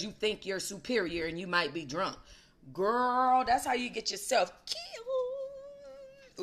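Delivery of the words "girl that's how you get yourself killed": sassy warning. Acted. Scolding the slapping woman.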